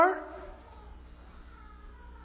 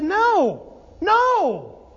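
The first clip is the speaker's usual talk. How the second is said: exasperated; 5 semitones higher than usual. Acted. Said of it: "He was exasperated with them".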